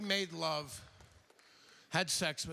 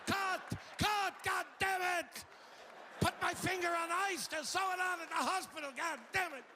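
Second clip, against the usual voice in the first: pained yelling; 13 semitones higher than usual. Pretend. Bitten dog trainer screaming at the crew to cut. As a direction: yell like your finger just got bitten. Imagined outtake, dog bite.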